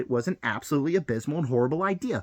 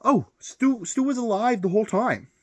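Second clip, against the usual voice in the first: mock studio reveal; 7 semitones higher, 3 dB louder than usual. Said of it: "Now we're just gonna pull it out".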